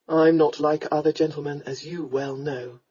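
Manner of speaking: low man's voice, slowing and softening; tender lord. Acted. Lord draws her near, tenderly. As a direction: reassure her low, intimately.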